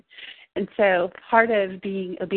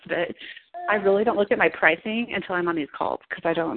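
ordinary talk

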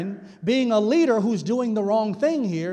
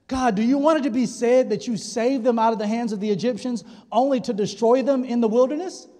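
normal talking voice

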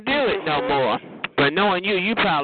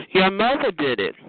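normal talking voice

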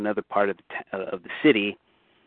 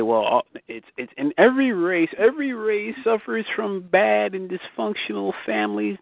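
voicing objectors, pitch climbing high; mock protest in a raised pitch; imitated objection; 10 semitones higher than usual. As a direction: push back as the objectors would, higher-pitched. Voicing people's anticipated objection.

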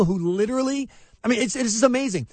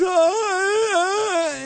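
mock baffled cry; 12 semitones higher than usual. Cashier baffled by the change.

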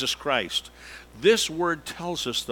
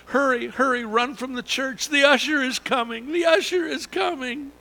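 panicked shout; 10 semitones higher, 5 dB louder than usual. Pretend. Panicked churchgoers fleeing usher.

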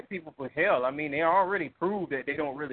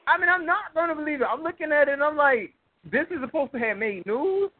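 incredulous; 10 semitones higher, 5 dB louder than usual. Real, not acted. Outraged disbelief at fake video.

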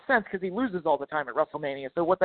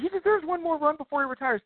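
high, softened mocking quote; mock pleading; 8 semitones higher, 4 dB quieter than usual. Imitating people who argue Kane deserves it, mock pleading. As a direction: parrot the fans in a raised pitch.

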